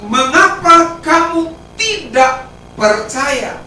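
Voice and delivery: slow rebuke. Acted. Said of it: "Jesus' rebuke drawn out".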